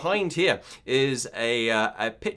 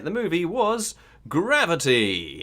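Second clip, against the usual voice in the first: slow, raised-pitch host reveal; showy answer reveal; 4 semitones higher, 3 dB louder, slower than usual. Real, not acted. Quizmaster revealing the film to players, game-show flourish. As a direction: stretch the final word out grandly.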